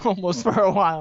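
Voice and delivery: teasing repeat of the phrase; mocking echo. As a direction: echo it back with disbelief. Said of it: "The fuck does that even mean?".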